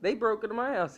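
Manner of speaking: high, smug self-assured voice; smug killer. Retold or imitated. Killer thinking he's free and clear, high and smug. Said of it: "So he thinks".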